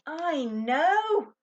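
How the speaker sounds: high, loud burst of excitement; boy bouncing on bed with a sudden idea; excited idea. A narrator's reading. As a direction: blurt it out thrilled. Ben has a sudden idea.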